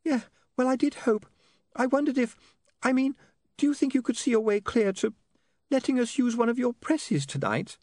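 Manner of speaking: put-on very high, girlish pleading voice; hesitant woman asking a favour. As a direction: ask timidly in a high voice, in halting phrases.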